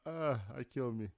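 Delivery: slow, self-amused chuckle after his own joke; corny dad laugh. Real, not acted. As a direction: savor your own bad joke. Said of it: "Such a dad joke".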